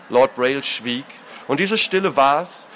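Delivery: ordinary delivery, nothing marked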